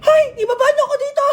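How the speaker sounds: high-pitched hammed-up cry for help; panicked demand. Teacher stuck up on the wall, panicked demand to students. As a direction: call out in comic panic.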